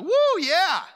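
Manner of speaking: high, loud giddy whoop; mock excited cheer. Pastor mimics an over-the-moon sign, high excited cheer. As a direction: cheer like a sign just thrilled you.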